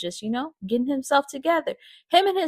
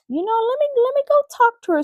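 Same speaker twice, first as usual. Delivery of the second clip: eager high quote; 14 semitones higher, faster than usual. Retold or imitated. Roy, eager to go talk to her, voice pitched high. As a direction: voice jumps much higher and quicker.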